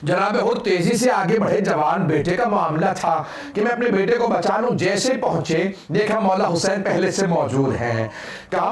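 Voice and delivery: ordinary delivery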